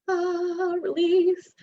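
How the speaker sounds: high, drawn-out "ah, release"; acted release. Speaker guessing how listeners let go, high mock release.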